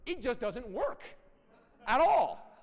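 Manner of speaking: shouted punchline. Real, not acted. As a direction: bursts out loud and high on the last words. Emphatic rant about beating kids.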